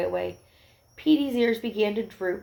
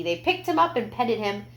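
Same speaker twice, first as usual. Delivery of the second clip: usual speaking voice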